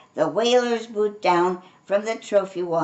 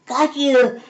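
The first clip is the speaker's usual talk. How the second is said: louder, higher triumphant cry; roar; 6 semitones higher, 8 dB louder than usual. A narrator's reading. Cub roaring as he dives for the hare. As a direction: pounce with a roar. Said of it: "Binky roared".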